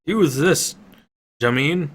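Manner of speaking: loud, high call across the fight. A narrator's reading. Warrior Baltic tossing a sword, calling loudly. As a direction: shout it over the battle noise.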